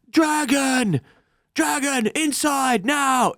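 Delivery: high-pitched imitation of a far-off yell; mock yelling. Recapping someone outside the council yelling a warning, mock high yell. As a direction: cry the alarm high, without real volume. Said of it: "we hear someone outside yelling".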